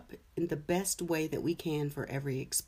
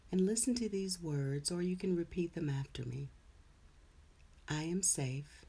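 calm, gentle closing words; guided meditation; 5 dB quieter than usual. Acted. Leads a meditation.

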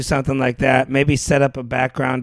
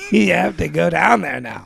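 ordinary talk, nothing marked

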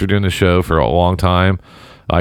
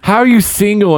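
incredulous; 11 semitones higher than usual. Real, not acted. Disbelief continues.

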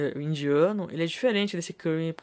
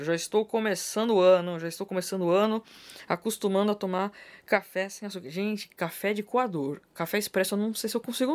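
usual speaking voice

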